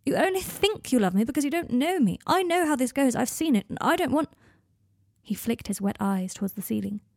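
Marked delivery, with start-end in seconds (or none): 0.0-4.3 high, pressing, upset argument; distressed. A narrator's reading. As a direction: plead it urgently, pitch lifted. Draco pleading in an emotional quarrel.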